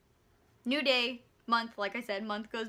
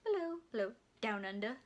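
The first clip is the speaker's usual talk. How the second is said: ordinary talk, nothing marked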